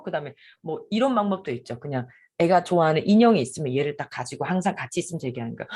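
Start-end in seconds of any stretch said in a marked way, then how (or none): none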